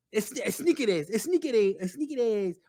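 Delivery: insistent mock Spanish accent; Mexican pronunciation. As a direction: keep trying "Snickers" in an exaggerated Mexican accent. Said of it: "how are they pronouncing this".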